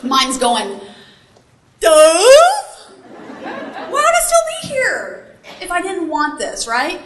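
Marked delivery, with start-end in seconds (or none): 1.8-6.7 high-pitched mock incredulous retort; exaggerated "duh" sarcasm, pitched up; sarcastic inner voice. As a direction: act out a snarky voice in the head. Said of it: "that voice in the back of your head, mine's going".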